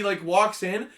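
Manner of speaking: ordinary talk, nothing marked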